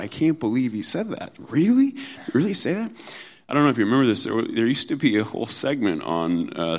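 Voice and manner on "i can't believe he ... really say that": really shot up very high, sarcastic; mocking disbelief. Scoffer ridiculing God's word, sarcastic high disbelief. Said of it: "They're, they're making fun of it".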